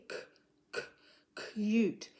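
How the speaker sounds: K popped alone, then CUTE; sounding out first sound. Shown as a demonstration. Teacher showing a child what we hear in CUTE, K sounded out. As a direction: isolate the K sound before saying CUTE. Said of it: "what can we hear?".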